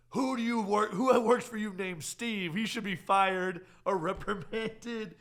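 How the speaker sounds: high, loud, drawn-out mock outrage; outraged demand. Corporate demands to know who Steve is, mock outraged.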